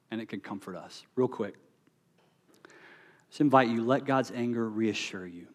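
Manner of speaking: normal talking voice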